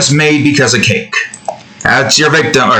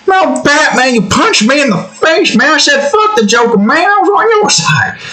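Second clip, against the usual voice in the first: high put-on whine at Batman; high indignant whine to Batman; whiny complaint; 13 semitones higher than usual. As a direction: protest like a wronged friend.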